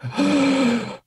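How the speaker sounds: sharp high gasp; a sudden high intake of breath; starstruck gasp. Retold or imitated. Fan spotting [name] in the store, a high gasp. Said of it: "just like".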